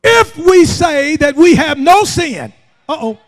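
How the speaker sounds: emphatic verse, stretched word by word, then driving; full-force scripture preaching. Real, not acted. Preacher quoting First John on sin, forceful preaching. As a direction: hammer the verse at the congregation.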